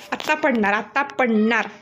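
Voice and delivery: usual speaking voice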